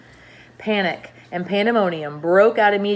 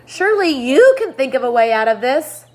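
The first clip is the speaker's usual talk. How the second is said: higher, louder pleading question; desperate plea; 6 semitones higher, 9 dB louder than usual. Acted. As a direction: appeal to James in despair. Ladybug pleads with James.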